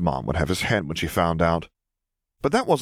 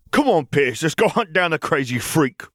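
bully howl; 9 semitones higher than usual. A narrator's reading. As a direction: whip up his crony to chase her. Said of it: "howled at Pierce".

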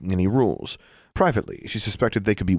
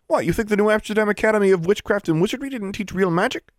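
put-on high, incredulous boyish taunt; cocky boy, taunting; 12 semitones higher than usual. A narrator's reading. Boy gloating over bound classmate, taunting. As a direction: crow it in mock disbelief.

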